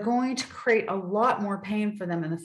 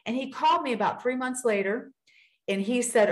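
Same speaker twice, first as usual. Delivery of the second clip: ordinary delivery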